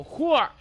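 imitating a loud hooah cry; mock hooah. Mocking his friend's in-game yell, loud mock hooah. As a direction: mimic his silly battle yell. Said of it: "You just go".

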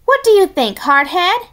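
high, loud call to the new bird. Acted. Matilda calls out to the new bird, high and loud. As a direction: call across the campfire.

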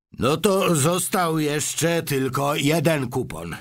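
put-on old man counting the last chance; tired grandfather's slow remark; weary old grandpa. Grandpa George sighs.

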